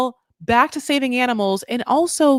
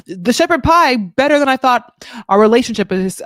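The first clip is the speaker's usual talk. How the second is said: loud mock verdict; 2 semitones higher, 8 dB louder than usual. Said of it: "she is like".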